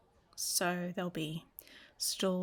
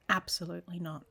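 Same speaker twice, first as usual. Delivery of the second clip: usual speaking voice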